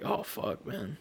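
very soft, voiceless mock exhaustion; spent groan. Sore player after a workout, quiet exhausted mutter. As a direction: let it out under your breath, drained.

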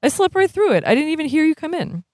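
high-pitched, earnest character voice; young brother in dialogue. Acted. Voices Mario in the story.

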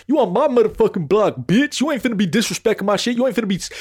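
hammed-up territorial challenge; mock confrontation. Neighbor warning an intruder off his block, mock-angry confrontation.

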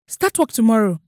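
loud, urgent plea; desperate loud capitulation; pleading. A narrator's reading. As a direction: plead loudly, giving in. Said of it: "he pleaded".

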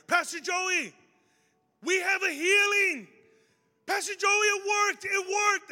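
high, loud, drawn-out cry of joy; excited shouting. Retold or imitated. Excited kid calls the pastor over about a healing, shouting high. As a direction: shout the news across the room, imitating someone thrilled.

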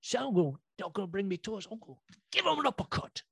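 high, soft imitated British boy; little British boy. British boy defying dad about Santa, imitated British accent. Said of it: "just in his little accent".

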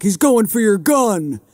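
high, loud, drawn-out mock police alarm; imitating officer's shout. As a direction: yell it out slowly like an officer raising the alarm. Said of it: "And then the officer's like".

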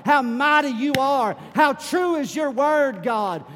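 loud, high shouted prayer; preaching at full force. Acted.

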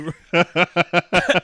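a loud, real laugh breaking into the rant; burst of laughter. Real, not acted. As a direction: crack up at your own line. Laughs mid-rant at own point.

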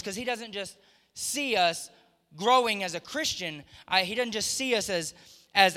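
loud, high preaching at full force. Acted. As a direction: lift the congregation with fervour.

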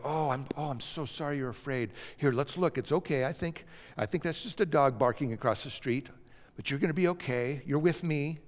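put-on calm a frightened child softly; reassuring mother. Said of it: "mother can go in and say".

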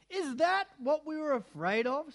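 high sneering creature voice. A narrator's reading. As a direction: jeer like a mocking brute. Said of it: "said another".

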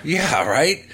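normal talking voice

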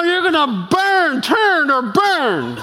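slow high mock hellfire; fire-and-brimstone preacher. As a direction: ham up the street preacher. Mocking fire-and-brimstone slogan.

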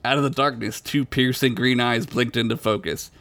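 ordinary talk, nothing marked

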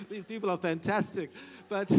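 laughing. Real, not acted. Laughing at own slip.

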